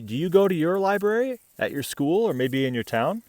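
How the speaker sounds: plain reading voice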